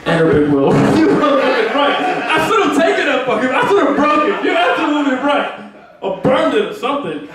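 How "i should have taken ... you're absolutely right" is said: ordinary talk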